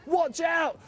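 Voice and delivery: high urgent cry; Tiny warning his friends. A narrator's reading. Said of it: "cried Tiny".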